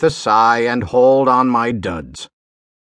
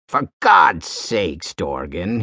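slow, strangled plea sinking lower; frightened gurgle, held down low; gurgled plea; 7 semitones lower, slower than usual. A narrator's reading. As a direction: beg slowly, voice dropping away. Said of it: "he gurgled".

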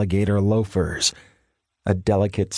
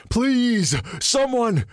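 desperate cry; 13 semitones higher, much slower than usual. A narrator's reading. Man hammering on door, high desperate cry. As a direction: cry out to anyone inside.

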